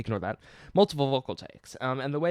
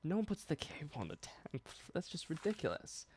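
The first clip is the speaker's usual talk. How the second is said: hushed mocking aside; whispering; 6 semitones higher, 10 dB quieter than usual. Real, not acted. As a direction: whisper it like a secret joke.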